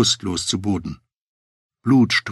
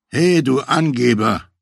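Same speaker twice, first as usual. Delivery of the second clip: drawn-out, louder, higher challenge; annoyed interjection; 4 semitones higher, 5 dB louder than usual. A narrator's reading. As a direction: cut in, irritated, stretching the call. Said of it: "mischte der verärgerte Robert sich ein".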